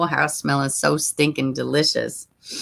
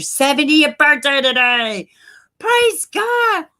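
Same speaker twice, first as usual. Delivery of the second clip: joyful praise; 9 semitones higher, 3 dB louder, slower than usual. Real, not acted. Birthday praise to viewer.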